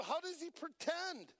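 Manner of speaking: ordinary delivery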